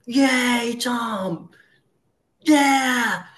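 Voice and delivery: high hammed-up whoop; mock crowd cheer. The whole meeting cheers Tom on, high mock whoop. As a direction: cheer wildly like a roomful of fans. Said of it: "again, the bullhorns".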